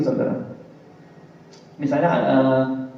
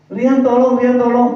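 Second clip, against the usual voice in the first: high, louder pleading call to Rian; mock cry for help; 8 semitones higher, 6 dB louder than usual. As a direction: act out a drowning man calling his friend. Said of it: "di air, gitu".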